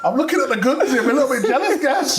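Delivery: high-pitched teasing through laughter; playful, giggly mock admiration; laughing banter. Real, not acted. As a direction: gush jokingly while cracking up. Teasing a teammate about physique.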